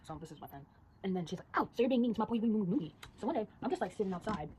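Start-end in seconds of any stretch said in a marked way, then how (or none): 1.6-2.9 louder, higher mimicked scolding; mock confrontation. Girl defending her boy, mock indignant. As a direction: call someone out in an exaggerated voice.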